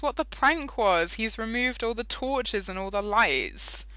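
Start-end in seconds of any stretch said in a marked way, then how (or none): none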